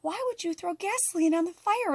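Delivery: high-pitched incredulous protest; exasperated challenge. Retold or imitated. Challenging partner for making the argument worse, exasperated. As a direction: retell it as an exasperated why-would-you.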